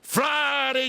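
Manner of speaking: high, drawn-out opening cry; stretched, ringing pulpit call; pastor preaching. Retold or imitated. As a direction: intone it like a preacher. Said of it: "He said it was Friday".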